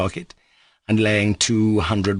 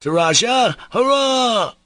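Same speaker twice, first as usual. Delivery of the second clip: loud, very high cheer; a jubilant drunken toast; shouted toast; 5 dB louder, slower than usual. A narrator's reading. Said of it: "he shouted again".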